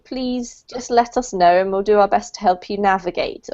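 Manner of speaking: ordinary talk, nothing marked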